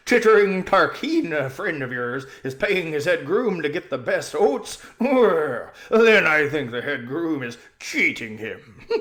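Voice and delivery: loud, chuckling horse voice; laughing horse. Acted. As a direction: laugh at the cheated master. Bree mocks Tarquin, laughing.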